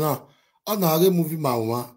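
ordinary delivery

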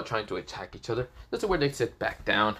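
plain reading voice

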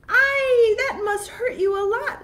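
very high, loud pitying cry; sympathetic cry. Acted. Big goat pitying the troll's sore foot, loud pitying cry. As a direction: feel the thorn's pain for her.

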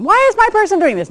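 high, puzzled voice of a worried dog; confused dog voice. Pretend. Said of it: "the dogs are all sitting in their room going".

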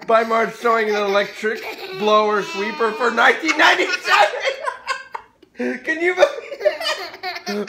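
loud, cracking up into a high squeal; laughing disbelief. Real, not acted. Parent reading a blower deal aloud, hysterically laughing. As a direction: announce the deal while dissolving into laughter.

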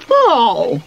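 high, loud cross squeal; angry squeak. A narrator's reading. Sweep spots the caterpillars back, squeaking angrily. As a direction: squeak out one cross cry. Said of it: "Sweep gave an angry squeak".

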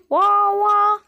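baby-like fake crying; mock crying wah. Toy pretending to cry, mock wail.

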